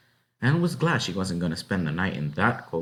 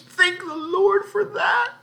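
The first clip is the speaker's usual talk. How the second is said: very high, slow sobbing voice; tearful cry; in tears; 6 dB louder, slower than usual. Acted. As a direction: relief breaking into crying. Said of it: "she burst into tears".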